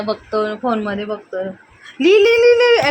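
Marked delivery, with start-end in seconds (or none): 2.0-2.9 high, loud rapid-fire urging; urgent nagging. Real, not acted. Mother hurrying child to write, loud nagging.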